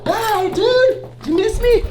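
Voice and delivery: high, bright, loud hello for the dog; excited dog greeting. Real, not acted. Greeting his dog after getting home, excited and high-pitched. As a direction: gush over the dog like he's a puppy.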